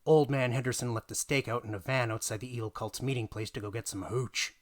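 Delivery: ordinary delivery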